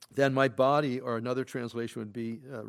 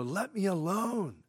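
angsty plea; 7 semitones higher, slower than usual. Acted. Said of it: "there's some angst here".

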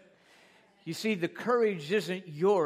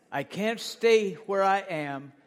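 loud declaration; 3 semitones higher, 7 dB louder, slower than usual. Acted. Preacher shouting the declaration.

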